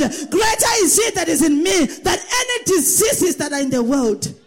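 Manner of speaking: rising, chanted preacher's declaration; preaching. Acted. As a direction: lead the congregation, building in force. Voiced declaration of faith.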